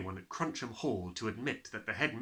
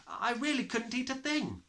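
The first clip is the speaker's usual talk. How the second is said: a schoolgirl making an excuse, pitched high; Lavender's voice; 12 semitones higher than usual. Acted. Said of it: "Lavender said".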